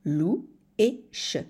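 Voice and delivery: "Lou et che" spoken slowly, each syllable set apart; slow teacher's split of "Lou" and "che". Shown as a demonstration. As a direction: break "louche" into "Lou" and "che" with a clear pause between them. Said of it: "Louche est un mot à deux syllabes".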